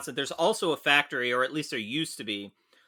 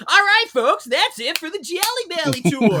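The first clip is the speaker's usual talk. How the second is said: very high, loud cheery guide; high, loud kids-show tour guide; tour guide voice back on; 10 dB louder than usual. Retold or imitated. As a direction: snap right back into the chipper host. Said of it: "turns around".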